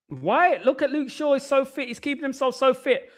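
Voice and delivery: high-pitched mock gushing fan voice; imitating questioning fans. Streamer mimicking people amazed at Shaw's fitness, high mock-fan voice. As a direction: put on the public's high, marvelling voice. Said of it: "people are asking the question".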